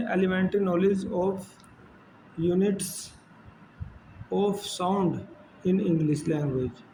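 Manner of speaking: drawn-out, word-by-word reading of a list; slow syllabus read-out. Acted. Teacher reading the English syllabus to students, slow and deliberate. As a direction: read each item out slowly, like dictation.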